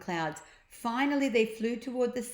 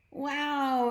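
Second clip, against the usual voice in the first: higher delighted wow; amazed exclamation; 7 semitones higher, 3 dB louder than usual. Acted. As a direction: gasp with joy. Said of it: "exclaimed Jemima".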